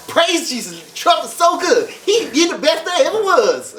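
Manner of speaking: mocking roommate's praise. Teller mocks hospital roommate praising Trump, high laughing voice. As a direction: send up the roommate gushing over Trump with a giggle. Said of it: "he'd be like".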